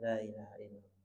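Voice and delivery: La ilaha illallah dilafalkan lirih dan rendah; light soft recitation. Shown as a demonstration. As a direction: lafalkan La ilaha illallah pelan dengan bibir terbuka. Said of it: "lebih mudah baca". (La ilaha illallah dibaca lirih)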